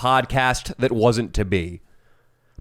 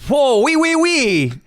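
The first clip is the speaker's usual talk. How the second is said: high, exaggerated French accent; mock French guy; 7 semitones higher than usual. French guy trashing America while needing help, high mock French accent. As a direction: sneer as a cartoon Frenchman. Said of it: "you could sell the French guy".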